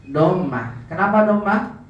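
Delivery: ordinary talk, nothing marked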